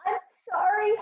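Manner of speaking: put-on high, tearful little-girl apology; crying child. A narrator's reading. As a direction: wail the apology like a small child. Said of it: "Harriet cried".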